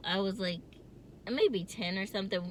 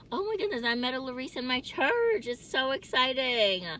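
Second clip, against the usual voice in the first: higher-pitched, thrilled delight; excited gushing; 6 semitones higher, 3 dB louder than usual. Real, not acted. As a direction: gush with real excitement, stretching the last phrase. Thrilled at meeting her namesake.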